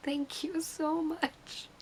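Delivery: tiny high, soft squeak; starstruck thanks. Retold or imitated. Fan walking off thanking Jensen, starstruck. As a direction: squeak out gratitude, overwhelmed.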